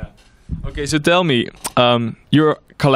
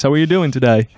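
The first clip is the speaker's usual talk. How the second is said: ordinary delivery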